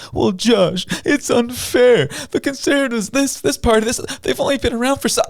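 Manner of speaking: high-pitched whiny mocking voice; mock complainer. Host parroting critics who call it unfair, mocking high-pitched whine. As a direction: imitate a protester in a squeaky, strained high voice.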